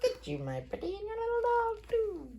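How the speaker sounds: high cackling witch voice; Wicked Witch voice. Pretend. Parent doing the witch line for child.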